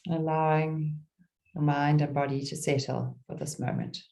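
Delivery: calm meditation guide with long pauses; gentle, spacious mindfulness voice; guided meditation. Real, not acted. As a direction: ease listeners into stillness, phrase by phrase.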